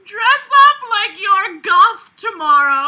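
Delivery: loud, slow, high through laughter; can't hold the laughter; cracking up mid-quote; laughing shout. Real, not acted.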